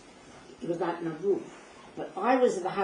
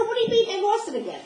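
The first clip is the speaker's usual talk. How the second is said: much higher, louder disbelieving cry; hysterical; 14 semitones higher, 7 dB louder than usual. Retold or imitated. Narrator hearing the Rebbetzin is lost, hysterical high cry. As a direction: shout it in frantic disbelief. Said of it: "I'm hysterical".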